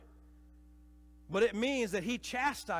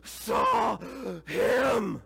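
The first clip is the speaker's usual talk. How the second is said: loud, breathless, words pushed out between breaths; panting after a seven-mile uphill run; 5 semitones higher, 9 dB louder, much slower than usual. Retold or imitated. Runners from Emmaus gasping out the news, breathless. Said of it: "trying to catch their breath".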